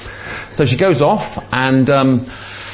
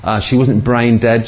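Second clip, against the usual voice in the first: ordinary talk, nothing marked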